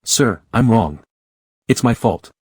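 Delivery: frightened, pleading denial; a hint of shaking fear; trembling with fear. A narrator's reading. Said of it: "His trembling voice betrayed evident fear".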